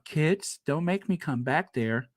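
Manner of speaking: ordinary talk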